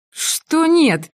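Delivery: резкий высокий вопрос; как громом поражённая; удивлённо. A narrator's reading. Мари удивлена отказом. (Мари не понимает Симона, удивлённо и высоко)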